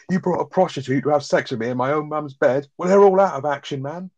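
half-acted fury, climbing up; storming-out rant. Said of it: "he comes storming out of the bedroom".

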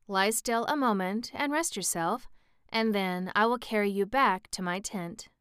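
plain reading voice

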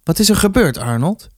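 high start, dropping on the name; businesslike, faintly worried. A narrator's reading. Mother to hurt son, businesslike but uneasy. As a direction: ask matter-of-factly with a touch of worry. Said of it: "vroeg het bijna zakelijk".

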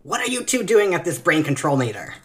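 high, loud affronted alien; indignant alien voice. Acted. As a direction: bark it like an outraged alien. Character confronts rivals.

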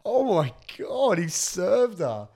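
high, loud, slow disbelieving gasp; shocked delight. Real, not acted. Friend hearing the comeback, loud and shocked. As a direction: stretch it out in stunned amazement. Reacting to the savage reply.

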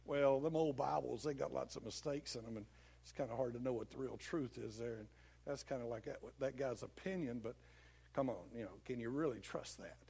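low, soft, casual tempter; hinting devil. Acted. Devil slyly hinting doubts to a believer, low and casual. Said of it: "that's the way he talks. He doesn't flat out attack. He kinda hints at it".

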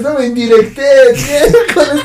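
excited. Real, not acted. Retelling the bus scene loudly.

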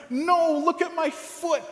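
high, insistent retold plea; urgent plea. Injured speaker insisting his brother look at his foot: high urgent plea. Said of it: "And I said".

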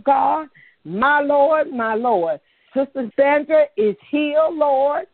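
rising, insistent proclamation of healing; fervent healing declaration. Real, not acted. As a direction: declare each name healed with growing fire. Declaring members healed one by one.

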